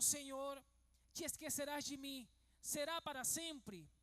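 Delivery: ordinary talk, nothing marked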